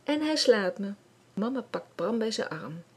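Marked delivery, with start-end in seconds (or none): 0.0-0.9 crying. Acted. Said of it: "huilt Marloes".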